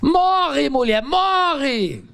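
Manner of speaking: high, slow, drawn-out jeer; mock Brazilian husband. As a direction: wail it back at the wife, hammed up. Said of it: "o que que vocês acham que eu diria pra ela".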